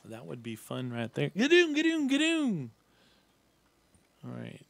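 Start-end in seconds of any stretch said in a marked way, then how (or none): 1.3-2.7 very high, loud gadoon noise; silly high-pitched noise-making; mock sound effect. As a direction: squeal out a playful bouncing sound effect. Playful imitated noise during gameplay.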